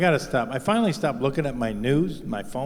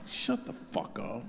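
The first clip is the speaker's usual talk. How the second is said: muttered aside; 9 dB quieter, slower than usual. Retold or imitated. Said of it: "I'm like".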